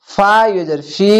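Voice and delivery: usual speaking voice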